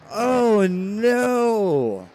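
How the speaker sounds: loud, drawn-out cry falling away; real race-caller anguish; dismayed wail. Real, not acted. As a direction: wail it out over the wreck. Laments crash of race leaders.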